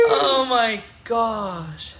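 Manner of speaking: helpless laugh breaking into a slow teary oh my gosh; laughing to tears. Real, not acted. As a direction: laugh until it almost turns to crying. Overcome with laughter, near tears.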